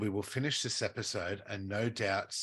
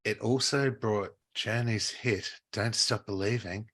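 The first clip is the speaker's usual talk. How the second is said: show intro read; 7 dB louder than usual. Acted. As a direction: open the show like a presenter.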